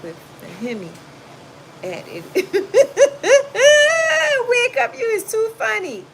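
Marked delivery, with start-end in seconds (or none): 2.3-4.4 high squealing laugh; high laughter. Real, not acted. Laughing hard at a funny viewer comment. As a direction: burst out in a high cackle.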